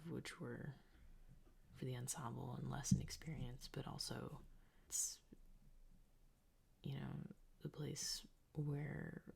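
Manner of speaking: soft breathy whispering diary; ASMR whisper. Acted. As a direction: whisper close to the mic. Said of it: "I hate A S M R ... I can't stop doing it".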